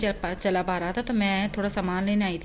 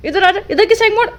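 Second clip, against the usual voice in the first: calling the dog; 12 semitones higher, 10 dB louder than usual. Real, not acted.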